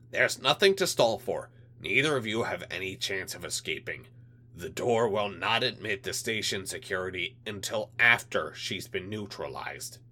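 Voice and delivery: a hint of a menacing alien; menacing alien official. Alien liaison threatens.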